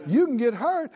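ordinary talk

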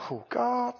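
very soft inner groan; small, quiet voice of mock dread; hushed dread. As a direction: think it aloud under your breath. Inner dread, thought to himself.

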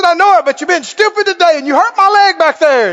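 high, loud comic grievance; play-acted exasperated complaint; mock angry grumbling. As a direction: argue back at full tilt, hammed-up. Acted quarrel, Balaam argues back.

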